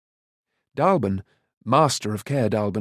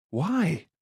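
mild cry; 5 semitones higher, 4 dB quieter than usual. A narrator's reading. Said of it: "Taran cried".

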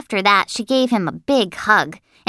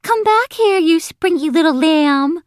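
high, loud, sing-out call; squeaky high, teasing shout; playful boy calling out; 10 semitones higher, 5 dB louder than usual. A narrator's reading. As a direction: call after her as you chase. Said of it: "Sheldon was calling to her".

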